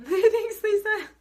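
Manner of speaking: very high, loud, laughing thank-you. Real, not acted. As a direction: laugh it out warmly. Laughs while thanking a viewer.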